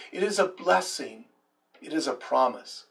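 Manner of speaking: normal talking voice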